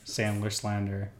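Sandler slander said slow and soft, each syllable spelled out; slow careful. Shown as a demonstration. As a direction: ease Sandler slander out slowly. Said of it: "the Joplin challenge is to try to say".

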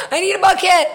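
yelling for a bucket, high and loud; retold scream. Mom retelling how she yelled for a bucket, high and loud. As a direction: call out urgently for a bucket mid-story. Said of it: "and I scream".

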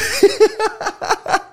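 high-pitched giggling fit; helpless high laugh. Real, not acted. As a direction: crack up at your own story. Cracking up at own story.

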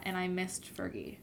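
usual speaking voice

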